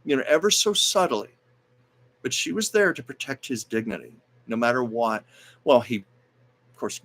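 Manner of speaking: usual speaking voice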